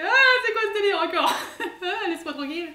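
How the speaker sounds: high, loud, fast laughing outburst; laughing exasperation. Retold or imitated. Koulouf to the Khan, high, fast and laughing. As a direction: throw up your hands, laughing.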